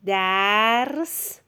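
درس long drawn, gliding upward; slow dictation. Shown as a demonstration. Teacher dictates for a pupil writing, very drawn-out. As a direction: stretch درس out slowly.

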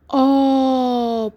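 آبا drawn out very slowly; stretched dictation. Shown as a demonstration. Teacher dictating to young pupils, stretching آبا slowly.

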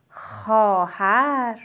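slow, falling; slow dictation. Shown as a demonstration. Teacher repeating the dictated word slowly.

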